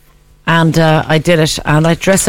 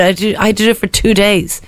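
ordinary talk, nothing marked

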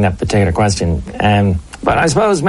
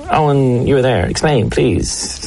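ordinary talk, nothing marked

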